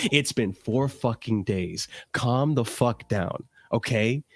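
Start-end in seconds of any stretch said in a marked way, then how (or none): none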